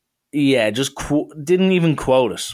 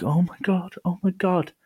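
hushed, high-pitched shock; shocked onlookers; 6 semitones higher, 6 dB quieter than usual. Retold or imitated. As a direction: imitate a stunned audience under their breath. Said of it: "half of the people are shocked".

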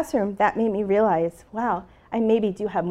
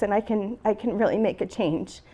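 ordinary delivery

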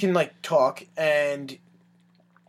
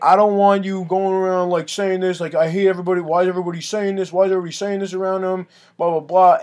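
raised, nagging scolding voice; drunk uncle; 4 semitones higher, 5 dB louder than usual. Retold or imitated. Drunk uncle griping about what everybody is saying, nagging. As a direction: imitate a complaining uncle, pitch pushed up. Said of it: "drunk uncle comes in".

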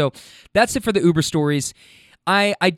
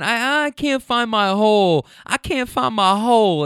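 higher, mock-helpless wail; tipsy passenger; 7 semitones higher than usual. Passenger fumbling with her seatbelt, mock helplessness. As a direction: imitate a woman complaining in a raised voice.

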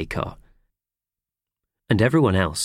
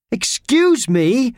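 slow, loud, high cover-up; an exaggerated loud excuse me; 6 dB louder, much slower than usual. A narrator's reading. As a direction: shout it to cover the noise. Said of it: "shouted Howard".

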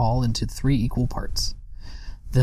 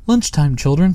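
louder, higher call across the garden; mother calling lunchtime, raised and bright; 5 semitones higher, 6 dB louder than usual. Acted. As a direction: call the children in to eat. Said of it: "she cried".

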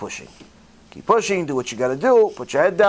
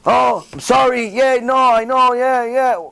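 caught thief apologizing; 4 semitones higher, slower than usual. Acted.

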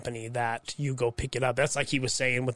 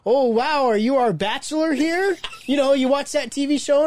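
much higher and louder jovial boss voice; hearty big boss; 12 semitones higher, 8 dB louder than usual. Retold or imitated. The big boss teasing him at the party, loud jovial voice. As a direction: boom it out like a cheerful boss teasing you. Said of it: "he's like".

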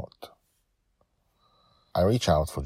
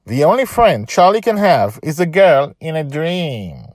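higher, louder mocking child; bully; 11 semitones higher, 10 dB louder than usual. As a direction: jeer at Charlie. Kid taunts Charlie.

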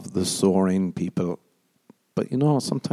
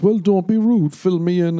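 mock pushy voice, higher; mocking a nosy acquaintance, pitched up; 9 semitones higher, 3 dB louder than usual. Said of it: "and they're like".

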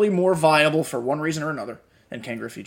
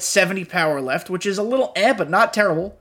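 ordinary talk

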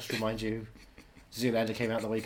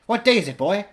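Scrooge shout; 6 semitones higher, 8 dB louder than usual. Acted. Scrooge on Christmas morning.

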